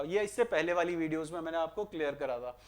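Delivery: ordinary talk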